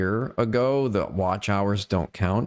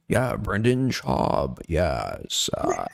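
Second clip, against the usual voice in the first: slow, laid-back imitation of a cool LA guy; mocking his LA guy affect; much slower than usual. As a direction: drag it out like a too-cool LA dude. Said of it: "he has that affect where he's like".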